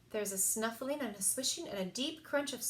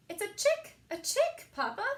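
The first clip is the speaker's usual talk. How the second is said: very high, loud, thrilled squeals; child penguin squealing with delight; 11 semitones higher, 6 dB louder than usual. Acted. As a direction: burst with joy at the chick. Pip sees the new chick.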